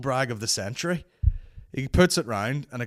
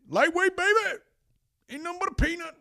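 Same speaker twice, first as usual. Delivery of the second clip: Ronnie Coleman impression. Imitating Ronnie Coleman at the gym, with a high hammed-up yell. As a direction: crow it like a strongman showing off. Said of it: "he's all like".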